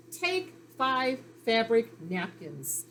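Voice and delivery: "Take five fabric" read very slowly; teacher slowly models the sentence. Shown as a demonstration. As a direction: sound the words out one by one. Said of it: "reading the sentence word by word".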